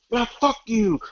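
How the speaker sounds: imitating the scammer cussing you out; retold yell. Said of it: "just start yelling at me".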